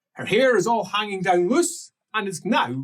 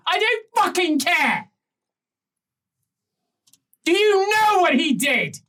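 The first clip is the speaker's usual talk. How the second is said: furious shout, pitched up high and loud; angry outburst; 12 semitones higher, 5 dB louder than usual. Acted. Countess yelling at the ambassador, a furious shout. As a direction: blow up at the flustered ambassador.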